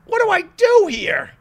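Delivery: repeated question in a high mock-helpless voice; exaggerated puzzlement. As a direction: throw up your hands comically. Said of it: "repeated question".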